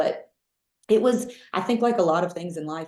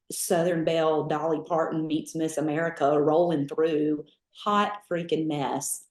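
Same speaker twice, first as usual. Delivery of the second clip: usual speaking voice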